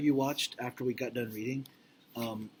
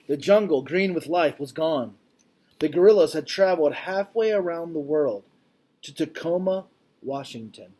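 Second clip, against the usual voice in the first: louder, expressive read-aloud voice; reading aloud to class; 4 semitones higher, 10 dB louder than usual. Acted. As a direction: read it out like a storybook for kids. Reads picture book to class.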